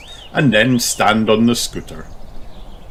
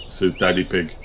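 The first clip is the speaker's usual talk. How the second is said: ordinary delivery, nothing marked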